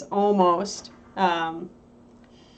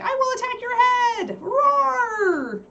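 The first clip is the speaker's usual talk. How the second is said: very high squeaky puppy voice; high mock-fierce little dog voice; voicing the puppy; 3 dB louder, much slower than usual. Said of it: "She's like".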